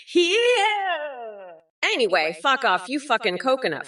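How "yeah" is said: smug little laugh. Acted. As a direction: chuckle at your own pun. Bot laughs at own joke.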